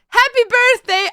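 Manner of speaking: high, loud celebratory call; cheery birthday greeting. Acted.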